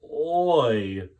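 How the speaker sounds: loud oy. Acted. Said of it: "said Missus Greenberg".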